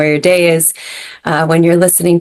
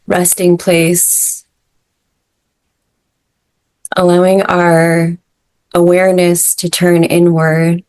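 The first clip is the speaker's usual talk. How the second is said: guided meditation. Acted. Leads breathwork meditation.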